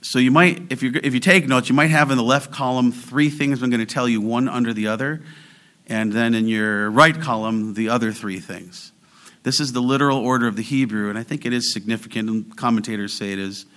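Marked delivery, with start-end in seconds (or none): none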